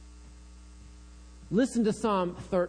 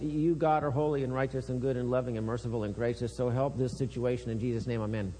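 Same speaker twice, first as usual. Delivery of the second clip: rushed rote prayer; 6 semitones lower, faster than usual. Retold or imitated. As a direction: gabble the praise to get it over with. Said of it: "you speak something like this".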